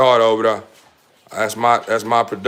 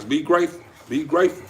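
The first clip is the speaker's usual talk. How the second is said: higher, gentle nudging voice; coaxing puppy; 6 semitones higher, faster than usual. Real, not acted. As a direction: coax the pup to behave, raised pitch.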